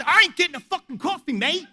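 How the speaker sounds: high, loud Australian protest; indignant Aussie outburst; exasperated Australian. Retold or imitated. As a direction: cut in fast with an Australian accent. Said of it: "this Australian guy".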